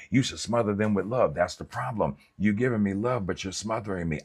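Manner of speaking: ordinary talk, nothing marked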